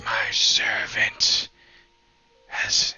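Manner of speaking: slow whispered pronouncement with long pauses; ghostly whisper. Acted. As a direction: whisper it solemnly, word by word. GM voices apparition speaking to Ro.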